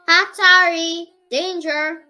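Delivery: loud warning. Acted. Reading a warning notice aloud.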